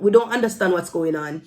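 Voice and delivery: normal talking voice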